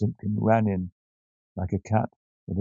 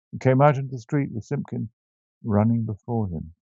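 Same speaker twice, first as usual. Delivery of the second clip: ordinary delivery, nothing marked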